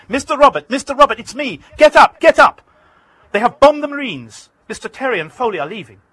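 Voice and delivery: high, loud, breaking with urgency; urgent panic. Retold or imitated. Landlord on the phone waking Robert in urgent panic. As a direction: wake him in alarm. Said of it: "His voice is breaking with urgency".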